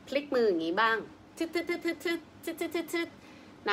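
high, very slow playful "ทึดๆๆๆๆๆๆๆ" sound effect; drawn-out "ทึดๆๆๆๆๆๆๆ" vocal effect; vocal massage sound. Shown as a demonstration. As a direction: sing out the rubbing noise.

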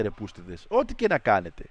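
normal talking voice

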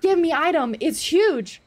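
high, loud burst of shock; real shocked outburst; startled shout. Real, not acted. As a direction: yelp it out at the giant thing. Startled by a huge monster.

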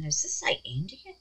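soft, voiceless question to self; whispered aside. Real, not acted. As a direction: whisper while checking a label. Reading label to herself.

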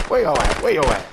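ordinary talk, nothing marked

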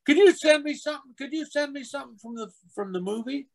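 put-on high, eager child's pleading; imitated little kid. Kid asks actor for a souvenir, high eager child voice. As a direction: beg in a small boy's high voice. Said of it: "his little kid".

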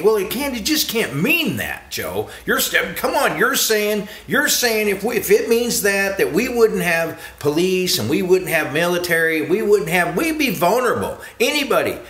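high, agitated objector's voice; protesting listener. Retold or imitated. Listener argues with Joe over the teaching, protesting. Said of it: "And you say".

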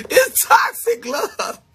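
words squeezed out through high laughter, slow; laughing. Real, not acted. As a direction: laugh it out, almost crying with laughter. Laughing over toxic love.

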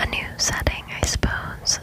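ordinary talk, nothing marked

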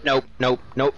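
ordinary talk, nothing marked

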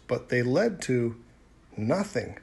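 usual speaking voice

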